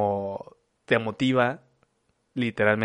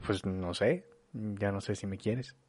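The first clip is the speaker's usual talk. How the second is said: much softer, lower, rising doubtful lines; doubting tone; 3 semitones lower, 10 dB quieter than usual. Shown as a demonstration. Partner doubting the lover's love, soft, low, unsure. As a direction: trail off unsure. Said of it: "En vez de decir".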